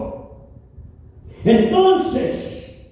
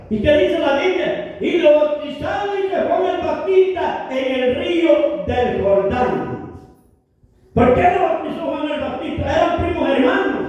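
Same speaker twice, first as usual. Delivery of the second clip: usual speaking voice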